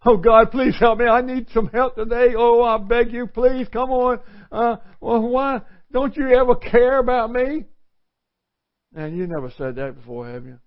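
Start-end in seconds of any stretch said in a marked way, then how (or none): 0.0-7.6 high, loud, begging cry to God; whiny frantic plea, pitched way up; desperate pleading prayer. Acted. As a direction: beg God like you're falling apart, higher and louder. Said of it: "we don't come this way".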